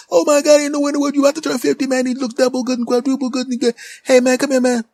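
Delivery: high, loud excited clerk; store clerk voice. Retold or imitated. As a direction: play the hyped-up shop guy calling out.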